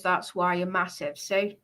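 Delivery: ordinary talk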